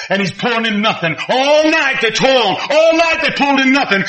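ordinary talk

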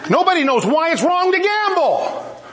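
preacher pressing the point, emphatic restatement. Shown as a demonstration. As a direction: hammer it home to the congregation. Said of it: "I did that for effect".

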